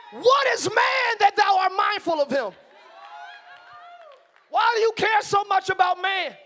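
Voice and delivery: high, loud whooping sermon; preaching at full force. Acted. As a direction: preach it hard with rising fire.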